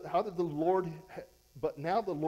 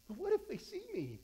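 mock timid believer; 10 semitones higher than usual. Preacher mimicking a fearful believer, timid whine. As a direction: play the scared churchgoer.

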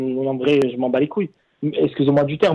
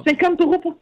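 outraged disbelief. Real, not acted. Repeats the offer in shock.

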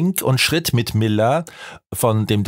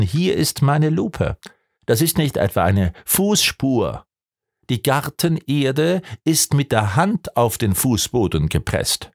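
usual speaking voice

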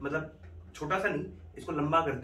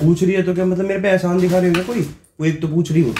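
louder and higher; faked angry shout; 5 semitones higher, 9 dB louder than usual. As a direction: pick a fight on purpose. Faked quarrel.

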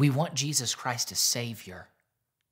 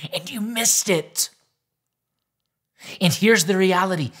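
much higher and louder, pleading preacher; preaching at full force; 9 semitones higher, 10 dB louder than usual. Acted. Pastor urging the church to live the truth, full-force preaching. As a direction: drive the truth home to the congregation.

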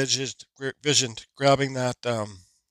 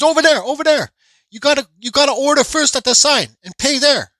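high mock voice of the cashier, pitched up; imitating the cashier; 11 semitones higher, 6 dB louder than usual. Retelling the hot dog cashier's order, mock high voice. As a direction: put on the cashier's voice, higher and a bit louder.

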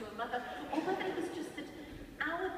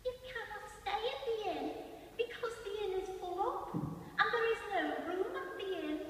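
put-on higher, quick little boy's piping voice; piping innkeeper boy; 9 semitones higher than usual. Little innkeeper boy shouting his line at Brian, high and quick. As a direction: pipe it up like a small innkeeper. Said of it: "the little fella playing the innkeeper pipes up".

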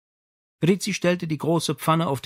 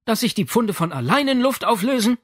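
sarcastic mother; 9 semitones higher, 3 dB louder than usual. Acted. Mother mocking.

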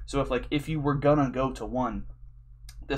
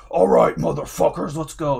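loud, gruff crowd-hyping frontman call; hype metal frontman; 8 dB louder than usual. Retold or imitated. Frontman firing up the crowd, loud hype call. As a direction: pump up the pit like a metal singer. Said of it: "on stage and be like".

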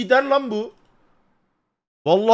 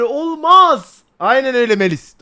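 usual speaking voice

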